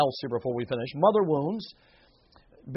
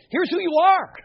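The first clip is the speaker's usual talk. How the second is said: much higher and louder, ARE held and falling; ringing proclamation; 14 semitones higher, 9 dB louder than usual. Acted. Preacher proclaiming the Bible's words to struggling listeners, ringing and loud.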